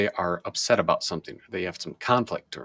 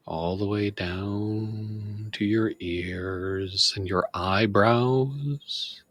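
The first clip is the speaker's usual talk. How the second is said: slow, calm meditation guide voice with long pauses; guided meditation. Shown as a demonstration. Demonstrating guided meditation for viewers, slow soothing guide voice. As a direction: ease the listener down, body part by body part. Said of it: "example of how we do guided meditation".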